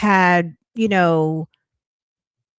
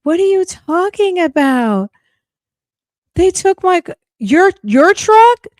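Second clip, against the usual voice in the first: high, outraged squeal of Shirley; mock Shirley, shocked and upset; imitating Shirley; 13 semitones higher, 5 dB louder than usual. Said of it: "Shirley was like".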